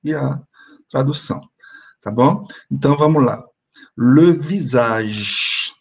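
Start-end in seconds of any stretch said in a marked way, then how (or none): 4.0-5.7 Le visage dito muito devagar, bem articulado; French model word. Shown as a demonstration. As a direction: model Le visage slowly for the class to repeat. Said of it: "Répétez". (Le visage bem devagar para a turma repetir)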